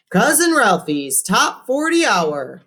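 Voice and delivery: high, slow showy radio host. A narrator's reading. Cousin Ralphie answering the caller, showy radio DJ. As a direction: sell it like a DJ on air.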